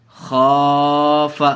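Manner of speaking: خاف with a held aa and short final f; teacher demonstrates the long aa in خاف; drawn-out vowel. Shown as a demonstration. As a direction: stretch the خا in خاف. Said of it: "خا کو کھینچ کے پڑھا".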